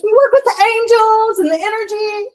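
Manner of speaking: high, loud mock-enthusiastic pitch; imitating gushing enthusiasm. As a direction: mimic a true believer, loud and way up high. Imitating therapist's excited pitch.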